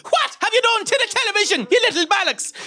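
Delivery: very high, screeching Irish tirade; hammed-up shrieking fury, Irish accent; unhinged Irish dad rage. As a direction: explode like an Irish dad at broken tech. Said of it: "that kind of unhinged boomer rage".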